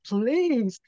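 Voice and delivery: high, pleading desperate prayer; retold tearful plea to God; pleading cry. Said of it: "I'm crying".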